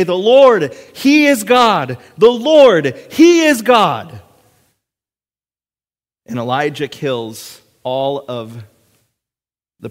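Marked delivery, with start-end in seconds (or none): 0.0-4.2 high, loud, drawn-out cry; crowd's proclamation. Acted. People seeing God's glory fall down and cry out, shouting. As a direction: proclaim it at full voice.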